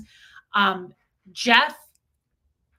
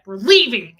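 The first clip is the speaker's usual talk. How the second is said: loud, sharp "We're leaving." with a falling end; husband ordering them out, loud; mock loud command; 9 dB louder than usual. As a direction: bark the order to leave. Fred storming out of party.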